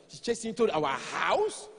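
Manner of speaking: slow questioning with a big rise on house; mock-shocked question; incredulous echo. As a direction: echo it as a disbelieving wife. Wife echoes him in disbelief.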